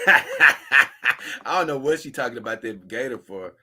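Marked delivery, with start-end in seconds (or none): 0.0-0.9 laughing. Real, not acted. Reading a funny tweet, laughing aloud. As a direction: laugh bursting through the word.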